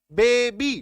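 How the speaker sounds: "बेबी" loud, as बे-बी; two clear syllables. Shown as a demonstration. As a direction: sound out "बेबी" with a clear long ee ending. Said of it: "दो syllable हो गया".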